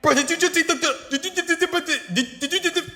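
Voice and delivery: very high, stammering disbelief; hammed-up squeaky shock, tripping over the words; surprised. Pirate seeing the fruit eaten, stammering high-pitched surprise. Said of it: "like, surprised that Buddy just ate".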